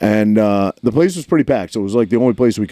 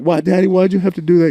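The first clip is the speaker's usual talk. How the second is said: lightly voiced upset child, higher; embarrassed daughter; 8 semitones higher than usual. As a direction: imitate a daughter reproaching her dad. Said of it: "She's like".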